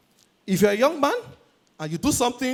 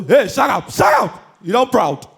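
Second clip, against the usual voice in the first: mock yelling caller; 2 semitones higher, 4 dB louder, slower than usual. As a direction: bark it down the phone.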